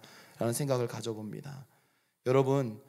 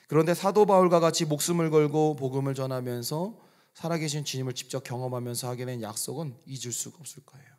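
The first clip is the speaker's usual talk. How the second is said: ordinary talk, nothing marked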